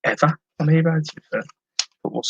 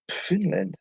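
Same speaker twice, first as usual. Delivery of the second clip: higher, softer try at a Finnish accent; attempted Finland accent; 6 semitones higher, 8 dB quieter than usual. Shown as a demonstration. Seller tries out a Finland accent for viewers, attempted Finnish accent. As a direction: attempt Finnish, sliding towards Swedish. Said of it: "kind of goes into my Swedish accent".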